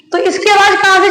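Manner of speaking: ordinary delivery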